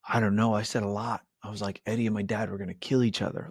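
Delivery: brushing it off, quickly; vague mumble. Retold or imitated.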